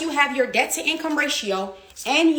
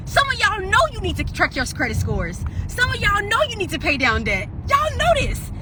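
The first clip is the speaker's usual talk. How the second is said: rising preach; 7 semitones higher than usual. Real, not acted. Preaching to viewers.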